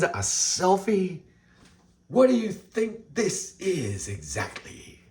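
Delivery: high, slow, drawn-out outrage; mock indignant star. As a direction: stretch it high like an offended diva.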